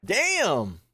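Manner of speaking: sudden loud high "Damn!"; loud exclamation. Real, not acted. Streamer reacting to the threat letter, loud.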